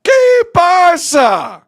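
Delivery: high, slow, stretched-out shouts; incredulous yelling. Real, not acted. Commentator aghast at a horrible penalty, incredulous yelling. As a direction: belt out each question in drawn-out disbelief. Stunned after saved penalty.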